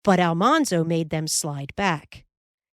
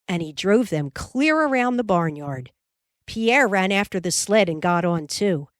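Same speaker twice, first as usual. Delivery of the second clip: plain reading voice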